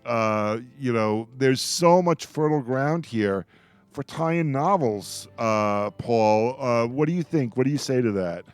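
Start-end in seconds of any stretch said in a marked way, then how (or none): none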